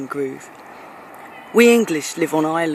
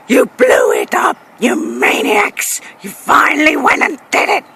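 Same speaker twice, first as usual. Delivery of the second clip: anguished film shout; 7 semitones higher, 5 dB louder than usual. Acted. Brit quoting American films, hammed-up anguished shout. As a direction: scream it in despairing movie-hero outrage. Acting out famous film lines.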